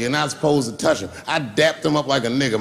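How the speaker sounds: normal talking voice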